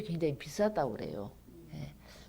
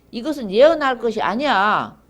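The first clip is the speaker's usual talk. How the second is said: loud, raised-pitch demand to stop; forceful recited complaint, easing at the end; acted protest; 3 semitones higher, 9 dB louder than usual. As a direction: declaim the hearers' objection to the prophet. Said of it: "그만해라고 그러는 거예요".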